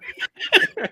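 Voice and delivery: squealing laugh, very high; high giggle at the joke. Real, not acted. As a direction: burst out in a squeal. Laughing at the quote.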